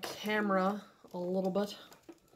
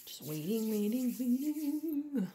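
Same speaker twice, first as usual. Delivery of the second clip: sing-song waiting; 5 semitones higher than usual. Real, not acted. Nail artist to viewers while the lamp cures, sing-song. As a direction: hum the waiting like a little tune.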